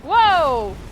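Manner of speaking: loud startled whoa; loud whoa, reacting to something too far; all caps whoa. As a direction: blurt it out like a shocked yell. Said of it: "the all uppercase whoa comes out".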